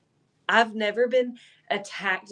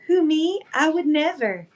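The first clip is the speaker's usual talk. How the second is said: high, louder mock-innocent voice; innocent cat; 7 semitones higher, 3 dB louder than usual. Cat after biting owner, voiced high and mock-innocent. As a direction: play the cat as falsely innocent. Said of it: "looking at me like".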